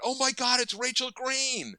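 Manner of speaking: put-on loud, high fan-girl outburst; reenacted giddy fan shriek; starstruck squeal. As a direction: squeal at spotting your idol, stretching the end. Acts out starstruck fan sighting star.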